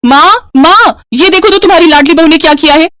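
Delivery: high, outraged call to mother; shrieking sister-in-law complaint; angry yell. Acted. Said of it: "Kavya गुस्से में चिल्लाते हुए कहती है".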